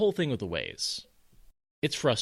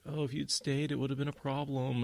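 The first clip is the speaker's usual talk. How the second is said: higher, mild mock mealy-mouthed protest; 5 semitones higher, 3 dB quieter than usual. Perrin's first, weaker try at telling her, mealy-mouthed. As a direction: imitate a timid, noncommittal mumbler, pitched up. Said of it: "mealy mouth".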